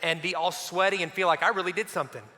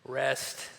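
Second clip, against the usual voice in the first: blissful sigh of rest; 5 semitones lower, 6 dB quieter, much slower than usual. Pretend. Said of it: "you just sit in it and you just think".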